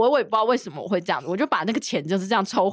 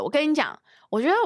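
ordinary talk

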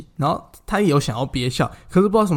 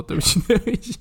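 laughing apology; 9 semitones higher than usual. Retold or imitated. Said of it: "边笑边道歉说".

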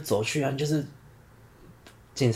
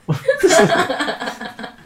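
high rising laugh. Real, not acted. Listener laughing at the story. (笑声越来越高)

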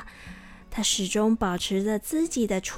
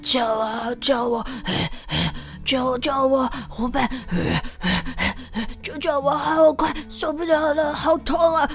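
growing desperate, crying out by the end; pained cries for help. Acted. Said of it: "一边大叫着说".

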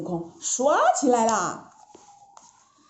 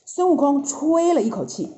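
plain reading voice

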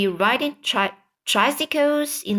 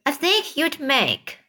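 sneering aunt; 6 semitones higher than usual. A narrator's reading. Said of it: "Anne Spiker said".

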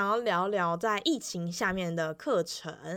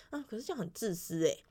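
quiet remark; 6 dB quieter than usual. Retold or imitated. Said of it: "他就默默地讲了一句说".

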